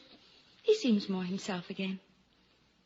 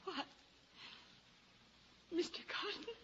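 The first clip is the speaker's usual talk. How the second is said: high, soft whispered question; startled whisper; 9 semitones higher, 17 dB quieter than usual. Acted. Lucy calls after departing Carton, whispered. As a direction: whisper his name in alarm.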